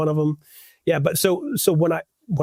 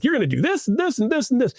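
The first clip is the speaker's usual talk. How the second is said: bossy designer; 9 semitones higher than usual. Retold or imitated. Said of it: "be like".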